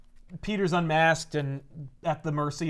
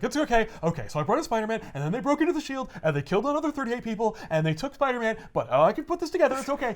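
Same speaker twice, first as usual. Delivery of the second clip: high-pitched acted panic; hurried self-reassurance while mock panicking; freaking out; 6 semitones higher than usual. Boss trying to keep it all together, freaking out. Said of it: "and he's freaking out".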